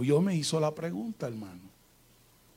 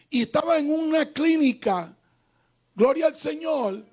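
high, sustained preacher's cry; fervent, ringing testimony; impassioned preaching; 9 semitones higher, 3 dB louder than usual. Real, not acted. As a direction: proclaim it to the whole congregation at raised pitch. Preaches at full force.

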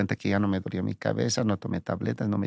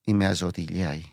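ordinary delivery

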